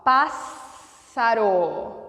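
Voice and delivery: "Pássaro" very slow, split before a long S sound; drawn-out syllables. Shown as a demonstration. Teacher showing students the digraph in "Pássaro", drawn-out syllables. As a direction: stretch "Pássaro" apart on the double S. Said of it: "Você pronuncia o S e o C como".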